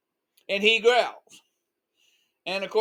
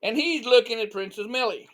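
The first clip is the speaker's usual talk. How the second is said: ordinary delivery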